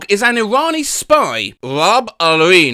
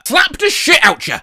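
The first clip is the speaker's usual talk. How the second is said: sudden high aggressive burst; 9 semitones higher than usual. Acted.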